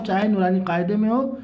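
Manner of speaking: ordinary talk, nothing marked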